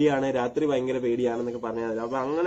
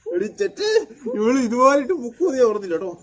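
laughing tease; 9 semitones higher, 5 dB louder than usual. Real, not acted. Teasing her through laughter.

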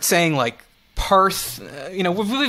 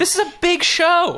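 mock outrage; 11 semitones higher than usual. Mock bragging on behalf of co-host.

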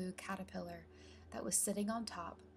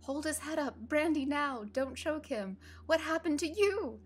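raised, high exclamations; loud excited babble of helpers; urgent crowd voices; 8 semitones higher, 7 dB louder than usual. A narrator's reading. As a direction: call out orders in a flurry. Excited crowd voices after accident.

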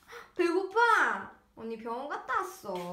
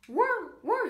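imitated bark; 7 semitones higher, 4 dB louder, slower than usual. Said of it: "이러네?".

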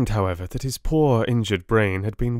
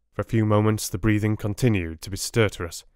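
plain reading voice

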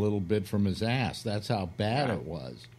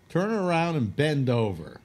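higher, louder commanding bear; bear's order; 6 semitones higher, 4 dB louder than usual. Acted. Bear orders the hunter to bend over, higher louder command. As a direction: bark the order down at him.

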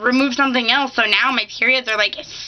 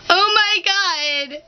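mock crying; 6 semitones higher than usual. Crying at the weather report, hammed-up tearful wail. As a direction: sob over the weather forecast. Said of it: "I'm just like".

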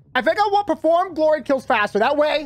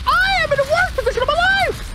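panicked wail; 13 semitones higher than usual. Real, not acted. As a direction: wail it, pitched way up. Panicked wail while losing in game.

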